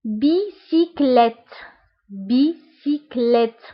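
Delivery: بيسيكليت said slowly, each syllable apart; teacher slowly modelling بيسيكليت. Shown as a demonstration. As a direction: spell out بيسيكليت slowly as French bicyclette.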